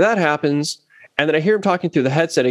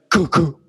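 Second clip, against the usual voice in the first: two short popping "coo" noises; imitated speaker pops; 2 semitones higher than usual. Musician mimicking his hot amp being plugged in, popping noises. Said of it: "It pops".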